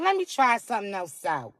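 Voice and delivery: low coy voice, dropping down; playful low-pitched self-talk; coy musing. Acted. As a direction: try it out in a low, sly undertone. Voices a shy private self-thought.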